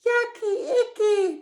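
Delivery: very high, laughing yucky; giggling. A narrator's reading. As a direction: giggle through the words. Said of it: "The owl giggled".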